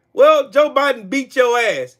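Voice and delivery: ordinary talk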